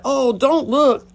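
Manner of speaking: high, loud, drawn-out protective plea; put-on comforting. Faking mama mode for a devastated friend, put-on concern. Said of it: "act like a comforting".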